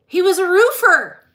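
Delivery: real startled excitement over the roofer; excited outburst. Real, not acted. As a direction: blurt it out thrilled by the discovery. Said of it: "I got really excited about that".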